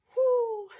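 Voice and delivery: loud high whoop on the press; effortful whoo. Real, not acted. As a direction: blow out a big high "whoo". Exhaling hard with each press.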